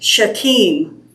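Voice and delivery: Shechem said slowly, she-KEM; slow, stressing the KEM of Shechem; second-syllable stress. Shown as a demonstration. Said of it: "put the emphasis on the second syllable".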